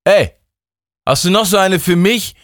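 high, loud call across the room. Acted. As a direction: grab his attention. Justus calls over for cigarette. (lauter, hoher Zuruf)

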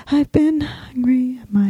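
walking away from the mic; fading out. Real, not acted. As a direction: voice fading into the distance. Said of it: "And she's fading out".

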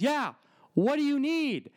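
high, slow mock clerk voice; impatient pharmacist. Pharmacist presses him again from the drive-thru, high and slow. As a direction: drag it out high and pushy. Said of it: "And she goes".

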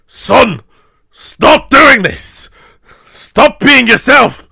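high, loud, slow mock yell of a father; a hammed-up angry dad telling his son off; mock stern father. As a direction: bellow like an exasperated Viking dad. Father scolding Hiccup, retold.

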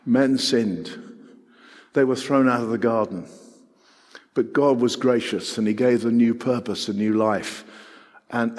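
ordinary talk

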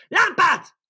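very high, loud bellow; full-throated roar. Acted. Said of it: "ordította teletorokból".

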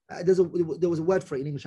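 ordinary delivery

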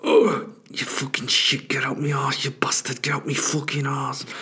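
put-on whispered, strained English-accented rant; hissed cursing through the effort of pushing; straining English guy. English stranger swearing at his poo, straining whisper. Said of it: "He goes".

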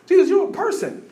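mock amazement at Jesus; surprised. People addressing Jesus, surprised.

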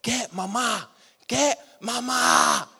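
rising, urgent call for mama, drawn out at the end; retold frantic yell. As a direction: brother yelling for help, half acted. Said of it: "He just screamed".

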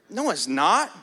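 normal talking voice

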